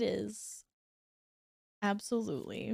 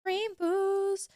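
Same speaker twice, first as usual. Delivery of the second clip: high, laughing text-to-speech imitation; imitating TTS voice; 10 semitones higher than usual. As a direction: copy the TTS lady saying it. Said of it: "I like when T T S lady says rainbows".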